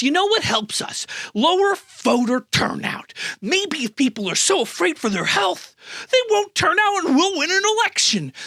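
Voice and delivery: high, exaggerated mock schemer voice; scheming Republicans. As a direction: pitch it up like a plotter thinking aloud.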